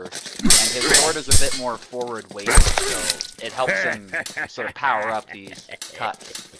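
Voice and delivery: lively caster commentary, punching the action calls; live play-by-play. Acted. Commentating a sword duel for stream viewers, lively play-by-play. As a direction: call the fight as it happens for your stream.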